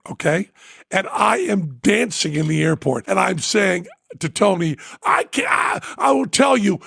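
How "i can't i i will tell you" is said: high excited yelling; retold ecstatic shout; overjoyed shout. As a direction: burst out with joy. Dancing in airport, overjoyed.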